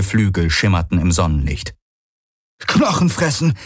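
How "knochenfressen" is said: calling out. A narrator's reading. Man spots the swarm in the sky, crying out. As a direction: cry out in alarm at restrained volume. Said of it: "rief er aus".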